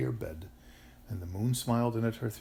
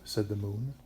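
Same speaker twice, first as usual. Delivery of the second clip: ordinary delivery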